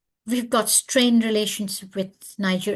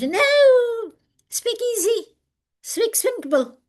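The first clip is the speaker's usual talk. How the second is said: much higher, louder insistent cry; emphatic no; 14 semitones higher, 6 dB louder, slower than usual. Real, not acted. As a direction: shout the warning out. Emphatic advice to the audience.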